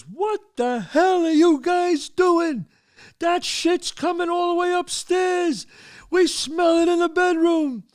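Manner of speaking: high, drawn-out yelling; furious father. Retold or imitated. Friend's father confronts stoned boys, furious high yelling. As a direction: bellow like an outraged dad catching them. Angry father bursts in, acted.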